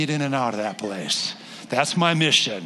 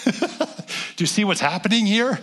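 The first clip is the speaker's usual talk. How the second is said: laughing; 8 semitones higher, slower than usual. Real, not acted. Laughs while asking congregation.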